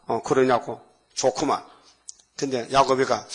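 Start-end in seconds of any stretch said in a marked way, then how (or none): none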